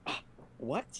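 whispered, rising what; hushed mock bewilderment; retold confusion. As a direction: breathe it out in disbelief. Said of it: "And I'm like".

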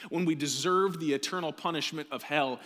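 building preaching. Acted. Pastor urging his church about Jesus' rescue, building to a shout. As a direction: build it up to a rousing peak.

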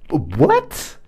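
usual speaking voice

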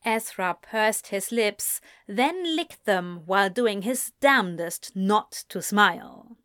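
ordinary delivery, nothing marked

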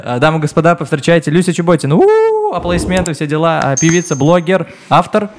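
конферансье объявляет гостя; торжественно, как ведущий шоу; ringmaster. Acted. Host announces guest like showman. (объявление артиста на манер конферансье)

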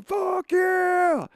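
high, thrilled cheer; excited shout. Retold or imitated. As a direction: yell it like a thrilled kid. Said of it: "I was sitting there going".

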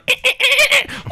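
shrill burst of laughter; high squeal laugh. Real, not acted. Reacts to sent dolphin sound.

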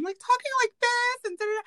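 anxious voice jumps way up high; high nervous voice. Shown as a demonstration. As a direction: show off the pipsqueak voice she gets when anxious. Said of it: "higher than it usually is".

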